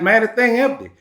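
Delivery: high, fed-up complaint; a touch of mock frustration; exasperated. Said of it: "You like".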